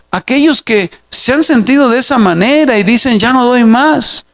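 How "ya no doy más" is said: slow weary quote. Retold or imitated. Tired believer quoted by pastor.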